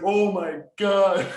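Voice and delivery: slow, high, loud oh my God; horrified. Retold or imitated. Patient hearing the doctor waited too little, slow high horror. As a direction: stretch it out in horror.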